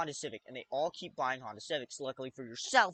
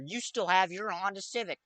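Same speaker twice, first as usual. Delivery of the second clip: normal talking voice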